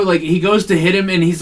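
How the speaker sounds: normal talking voice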